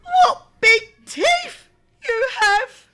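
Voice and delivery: put-on amazed little girl. A narrator's reading.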